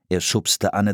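usual speaking voice

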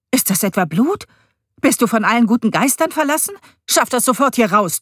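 high, loud furious scolding; angry scolding. A narrator's reading. Stepmother scolding her stepson over the antler, furious. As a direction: tear into the boy.

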